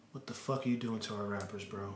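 soft, low, muttered repeat; muttered aside. Acted. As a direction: drop it under your breath, exasperated.

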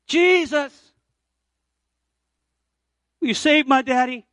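put-on high, loud crying-out prayer of a desperate child; pleading shout. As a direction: call out to Jesus with everything you have. Boy's desperate prayer at altar.

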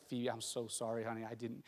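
low, soft, pleading apology; begging apology. Retold or imitated. Dad begging daughter for forgiveness in the hallway, soft pleading. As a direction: re-enact a quiet, rushed plea. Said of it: "I'm just begging her for forgiveness".

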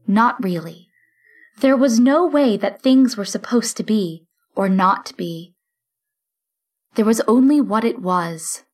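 ordinary delivery

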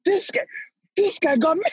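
high-pitched, incredulous retelling; halting, amused disbelief; retold astonishment. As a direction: act out being stunned by news.

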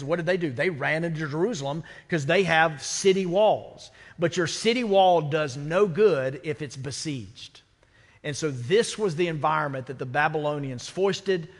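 ordinary delivery

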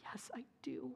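soft unvoiced answer through tears; tearful whisper. The hard-hearted coworker answers the little boy, tearful whisper. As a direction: barely breathe the words out, moved. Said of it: "started crying".

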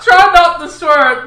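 loud, slow quote bursting with laughter; laughing repeat. Retold or imitated. Repeating the co-host's line for the laugh, loud and laughing. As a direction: echo the line while cracking up. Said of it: "I love that".

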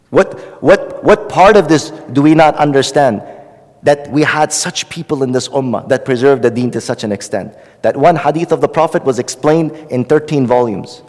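ordinary talk

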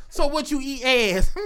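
ordinary talk, nothing marked

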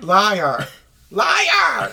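playful yell building to high pitch; hammed-up accusing cry; mock screaming. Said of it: "screaming at the top of your lung".

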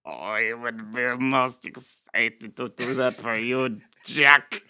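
slow, obliging German-accented impression; Christoph. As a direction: agree eagerly as a courtly German.

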